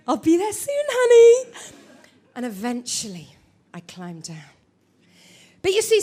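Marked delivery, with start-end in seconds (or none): 0.1-1.5 teasing husband. Retold or imitated. Husband teasing behind the door.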